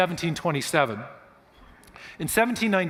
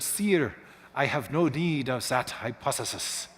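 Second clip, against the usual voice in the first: hammed-up French accent, puffed-up delivery; Laplace's French accent; 3 semitones lower, 4 dB quieter, slower than usual. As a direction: answer pompously in a put-on French accent. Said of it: "that was my French accent".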